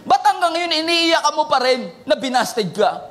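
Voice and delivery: ordinary talk, nothing marked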